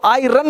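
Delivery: आयरन with a full R, eye-RUN; wrong pronunciation. Shown as a demonstration. Teacher repeats what to avoid, R sounded. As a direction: pronounce the R in आयरन. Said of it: "नहीं बोलना है".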